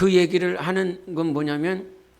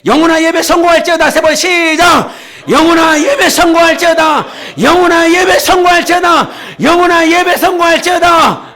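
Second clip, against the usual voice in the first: full-force high congregational chant; 외치는 선포; 10 semitones higher, 10 dB louder than usual. Shown as a demonstration. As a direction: shout the declaration to rally everyone. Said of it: "또 해". (회중과 함께 힘껏 높이 외치는 선포)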